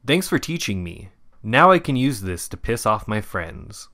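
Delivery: ordinary delivery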